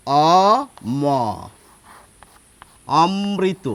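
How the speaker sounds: অ, ম apart, then অমৃত as om-mri-to; teacher sounding out অমৃত as om-mrito; syllable breakdown, doubled m. Shown as a demonstration. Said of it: "সেটি হওয়ার কথা এইরকম".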